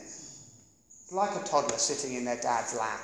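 ordinary talk, nothing marked

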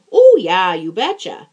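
light Minnesota "Oh, yeah, you betcha"; breezy Upper Midwest accent, imitating locals. Friend mimics the Minnesota catchphrase, light Minnesota accent. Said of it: "the big sort of cultural response".

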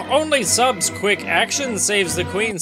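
usual speaking voice

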